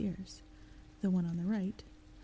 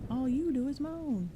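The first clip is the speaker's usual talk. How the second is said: yelling back; 6 semitones higher, 3 dB louder than usual. Acted. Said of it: "the second knocker yelled back".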